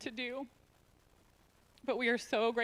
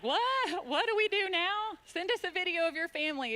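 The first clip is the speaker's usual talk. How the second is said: shocked; 9 semitones higher, 4 dB louder than usual. Retold or imitated. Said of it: "And I was like".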